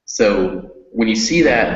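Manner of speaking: ordinary talk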